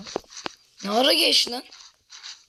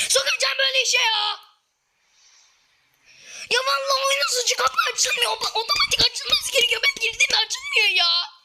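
real gamer rage, yelling at the broken door; furious screaming; 14 semitones higher than usual. Real, not acted. Streamer screaming at a stuck door after losing his loot, furious. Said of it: "vallahi çok sinirliyim".

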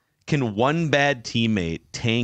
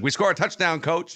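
high, cocky mock reply; 9 semitones higher than usual. Quarterback mocking his coach after a touchdown, cocky and high. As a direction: needle the coach, higher. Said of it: "he was just kinda mocking him".

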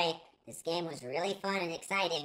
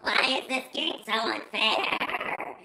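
slow, louder whining complaint; mock lament; 3 semitones higher, 5 dB louder, slower than usual. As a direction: drag out each word in theatrical grief. Lamenting the loss slowly.

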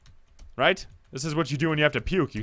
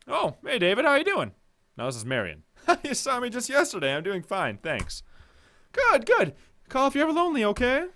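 Marion's voice; 7 semitones higher than usual. Acted.

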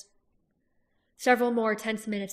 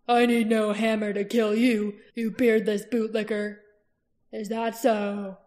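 louder defiant insult, slowing at the end; defiant retort; 6 dB louder than usual. Acted. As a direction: spit back the insult. Roran trades insults with Barst.

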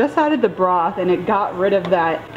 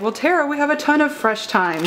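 quoting mom; 8 semitones higher than usual. Retold or imitated. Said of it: "so she was like".

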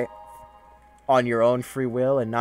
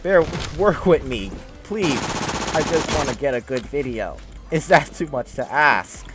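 pleading; 4 semitones higher, 5 dB louder than usual. Real, not acted. Gamer begging bear, frustrated.

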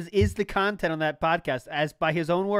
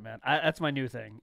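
ordinary talk

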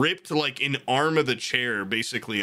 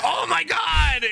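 high, drawn-out exclamation; amazed outcry; 3 dB louder, slower than usual. Retold or imitated. Retelling a reaction to the find, amazed outcry.

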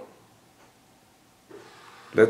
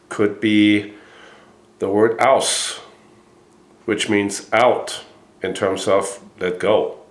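usual speaking voice

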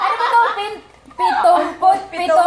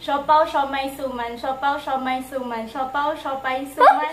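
fast tongue twister; 3 semitones lower, 6 dB quieter than usual. Shown as a demonstration.

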